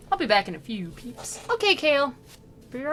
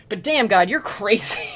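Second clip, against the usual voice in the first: louder, emphatic "damn, God"; emphatic disbelief; 7 dB louder than usual. Retold or imitated. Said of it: "So he's like".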